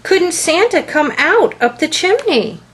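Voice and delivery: excited. Acted. Harold asks about Santa escaping, excitedly. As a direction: ask it bubbling with eagerness. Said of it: "Harold asked excitedly".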